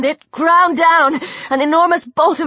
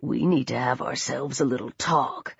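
low, hushed threat; soft, ominous thug voice; menacing attacker; 10 semitones lower, 10 dB quieter than usual. A narrator's reading. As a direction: drop deep and quiet to intimidate. Attacker menaces his victim.

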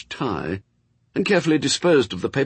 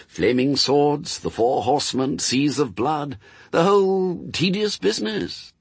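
lightly voiced angel, higher pitched; resigned angel, explaining; 6 semitones higher than usual. Angel explains the final test to demon, lightly voiced. Angel's dialogue in narrated story.

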